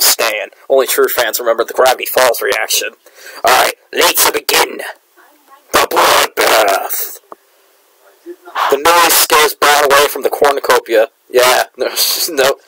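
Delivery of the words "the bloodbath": dramatic announcer. Pretend.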